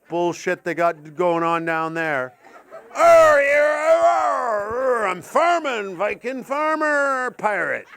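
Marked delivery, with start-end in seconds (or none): 2.9-7.9 high, drawn-out West Country pirate-farmer; mock West Country accent. Comic mocking West Country locals, loud drawn-out pirate-farmer accent. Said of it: "West Country weird fucking pirate farmer hybrid".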